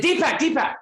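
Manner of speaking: high, loud desperate calling; Wayne Dyer calling. Retold or imitated. Wayne calling to his friend Deepak, high and loud. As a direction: call out to your friend in a panic. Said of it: "And I said".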